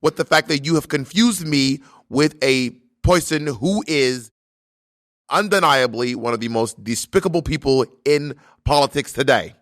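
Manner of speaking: mock-outraged politician with heavy accent; Bernie Sanders. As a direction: take offence grandly, pausing between phrases. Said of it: "I'm gonna do a voice".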